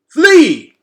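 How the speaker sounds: sudden high, loud shout of alarm. A narrator's reading. Henry shouting at Gregor to run, loud alarm. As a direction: yell it as a warning. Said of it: "Henry shouted at Gregor".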